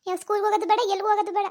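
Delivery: normal talking voice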